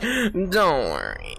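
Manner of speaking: slow, drawn-out mock seduction; seducing voice. Player teasing friend about how their voice sounds, mock-seductive. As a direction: imitate a smooth seductive voice, very slowly. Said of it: "the way your voice sounds".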